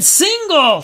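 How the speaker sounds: high, loud announcer's yell; excited shout. Pretend. Announcer calls a base hit, excited shout.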